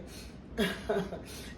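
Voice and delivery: breathy laugh after tears; soft laugh. Real, not acted. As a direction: laugh it off gently. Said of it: "Let me laugh again".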